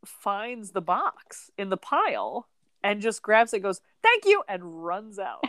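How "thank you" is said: high, loud, quick-exit thank-you; comic grab-and-go thanks; hurried mock thanks. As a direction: blurt it high and loud, imitating someone about to run off. Man grabs box and bolts.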